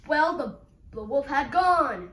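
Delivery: loud, falling statement; loud announcement. Acted. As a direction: proclaim it firmly at full voice. Announcing wolf gone, then questioned.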